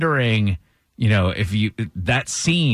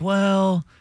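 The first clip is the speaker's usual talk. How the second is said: mock soothing wife; 6 semitones higher, much slower than usual. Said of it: "And she's like".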